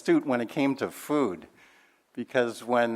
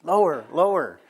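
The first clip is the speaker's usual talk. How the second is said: calling out; 6 semitones higher, 7 dB louder than usual. Retold or imitated. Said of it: "they're going".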